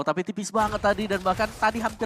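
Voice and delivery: roaring victory call; fighting game announcer. Real, not acted. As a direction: scream the final push. Game-winning push, caster hype. (teriakan kemenangan komentator)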